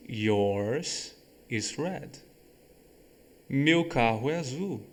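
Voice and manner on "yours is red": very slow, drawn-out example; slow teacher model sentence; slow demo. Shown as a demonstration. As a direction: drag out the example slowly. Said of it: "Eu vou falar outra".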